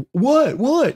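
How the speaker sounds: high, feigned innocent what; innocent child. Retold or imitated. Dad imitating daughter's feigned innocent what. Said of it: "she's like".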